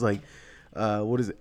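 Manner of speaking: ordinary delivery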